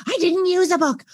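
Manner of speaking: put-on a kid pouting back; sulky child. Child answering the parent, sulky.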